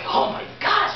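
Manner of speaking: imitating her thrilled gasp; retold girlfriend's delighted reaction; excited gasp. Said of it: "she was excited".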